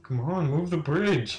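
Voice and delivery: loud, drawn-out yell at the game; shouting at the screen. Real, not acted. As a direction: yell for the bridge to move. Yelling at game character.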